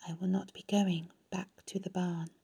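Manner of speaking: barely audible, whispery and weak; very low voice. A narrator's reading. Dying spider breaks it to her friend, very low voice. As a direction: say it faintly, almost too low to hear. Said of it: "in a voice so low Wilbur could hardly hear".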